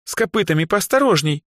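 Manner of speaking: high, indignant warning; affronted high-pitched complaint; indignant rebuke. A narrator's reading. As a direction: scold after being jabbed. Rebuke after an elbow jab.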